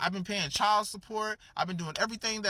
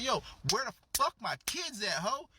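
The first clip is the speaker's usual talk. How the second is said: mock-angry demand, pitched up; high, mildly hammed-up anger; acted pissed off; 6 semitones higher than usual. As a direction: play the fed-up wolf demanding answers. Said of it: "He got pissed off".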